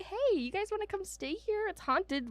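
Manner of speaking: high-pitched mock invitation; mock sales pitch. Host imitating castle owners inviting guests, high mock pitch.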